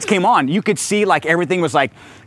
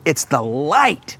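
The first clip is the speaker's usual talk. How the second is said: slow reverent answer; much slower than usual. Shown as a demonstration. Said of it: "And you go".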